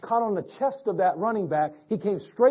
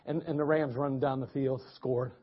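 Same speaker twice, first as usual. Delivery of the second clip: usual speaking voice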